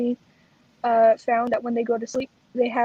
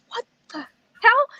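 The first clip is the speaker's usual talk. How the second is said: startled surgeons; 11 semitones higher, 4 dB louder, slower than usual. Retold or imitated. Surgeons react to rolling monitor.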